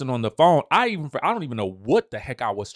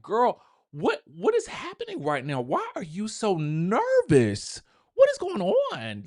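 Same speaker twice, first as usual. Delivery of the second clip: high, drawn-out disbelief, much higher; incredulous girl; 8 semitones higher than usual. Retold or imitated. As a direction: ask like you can't believe it, slowing down. Mock-questioning a nervous castmate.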